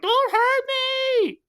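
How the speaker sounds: put-on high, slow squeal of a scared little kid. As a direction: squeak it out like a frightened child. Little kid voiced in retelling.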